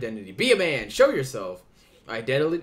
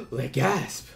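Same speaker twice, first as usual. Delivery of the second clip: mock gasp; 5 semitones higher than usual.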